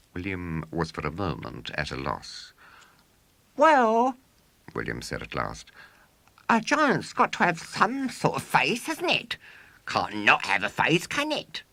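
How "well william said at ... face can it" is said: put-on high, loud boastful showman boy. A narrator's reading. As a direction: crow it like a swaggering boy showman. William boasting to audience.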